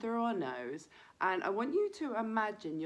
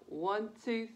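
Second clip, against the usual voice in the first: ordinary delivery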